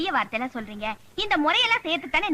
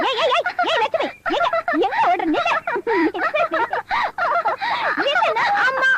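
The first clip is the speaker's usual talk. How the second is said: calling out; 9 semitones higher than usual. Acted. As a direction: shout after her to stop. Calling after Lakshmi running off.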